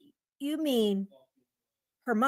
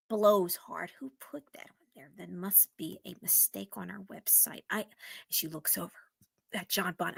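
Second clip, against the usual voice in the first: whispered, much lower and softer; hushed whisper; 8 semitones lower, 9 dB quieter than usual. Acted. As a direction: whisper it like a secret. Whispered narration and woman.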